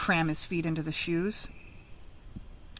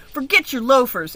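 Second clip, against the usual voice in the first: louder, higher sharp retort; snapped; 5 semitones higher, 6 dB louder than usual. Acted. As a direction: cut him off impatiently. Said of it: "Angel snapped".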